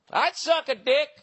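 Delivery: put-on high, loud drunk loudmouth; drunk guy starting up. Drunk guy starting up again on the bus, loud high impression. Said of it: "he started going again".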